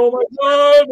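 sudden high, loud tearful exclaim; crying out as the tears start; overwhelmed cry. Retold or imitated. Fan hearing the promo replayed, crying out overwhelmed. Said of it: "fucking tears are coming down my face".

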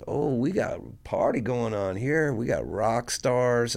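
high, sing-out mocking greeting, slowing at the end; retold taunting officer. Older policewoman walking in on the captives, gloating mockery. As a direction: crow it at your captives, stretching the last words.

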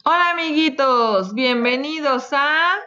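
high, loud sing-song greeting; bright squeaky sing-song welcome. Acted. Saludo cantado a los niños. (saludo cantarín y agudo)

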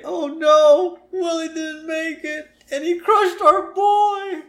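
very high falsetto wail, slow; hammed-up horrified mother in falsetto; distraught woman. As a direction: cry out as a panicked movie mom. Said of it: "we hear a woman say".

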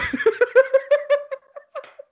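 long, loud, high-pitched laugh. Real, not acted. After quoting Reigns, real loud high laugh. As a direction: crack up fully.

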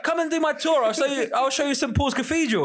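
high, pestering wasp voice; wasp tour guide. Pretend. Wasp touting cathedral tours to passers-by, high mock sales pitch. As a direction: pitch it up as an eager insect guide. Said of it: "the wasp is, uh, just floating in your face".

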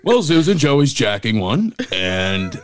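mock radio announcer. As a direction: swing into the big homer call. Mock radio home run call.

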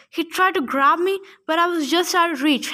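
ordinary delivery, nothing marked

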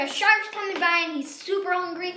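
plain reading voice

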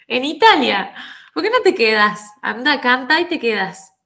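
mother urges her daughter to stay in Italy, insistent. Retold or imitated. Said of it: "Digo, "¿Quedarme en dónde?".

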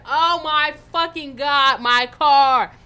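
loud, drawn-out wail of outrage; retold scream. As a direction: re-enact screaming over a wrecked car, slow and very loud. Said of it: "sitting there in the car screaming".

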